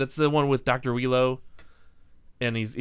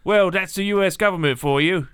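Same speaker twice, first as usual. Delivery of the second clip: higher-pitched, hammed-up thick British accent; mock Cockney accent; 5 semitones higher than usual. Agent answering about the government, in thick mock British. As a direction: put on a broad, bad Cockney. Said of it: "like, full Cockney".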